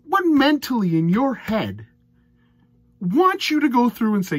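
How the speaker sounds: loud, high, exasperated rant at the player; fed-up darts fan ranting loudly. Real, not acted. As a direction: vent in disbelief, almost yelling. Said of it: "Sorry for my rants".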